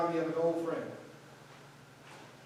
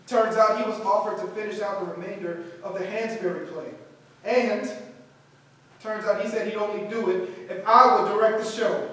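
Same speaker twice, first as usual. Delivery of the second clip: excited; 3 semitones higher, 8 dB louder than usual. Acted. Announcing news to students.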